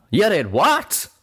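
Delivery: high, loud, slow outraged question; Calamity snapping. A narrator's reading. Friend hearing of the memory orb, snapping. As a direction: draw it out, incredulous and angry. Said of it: "Calamity snapped".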